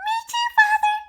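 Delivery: put-on happy puppet boy to his father; Pinocchio. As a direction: chirp it happily.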